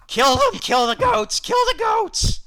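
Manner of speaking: put-on high, loud wailing child voice; crying kid. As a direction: yell it like a hysterical child running for help. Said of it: "like, crying and just".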